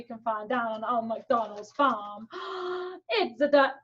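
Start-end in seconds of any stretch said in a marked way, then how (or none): 2.3-3.0 quick delighted gasp; surprised gasp. Acted. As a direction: gasp at spotting the duck. Discovering duck.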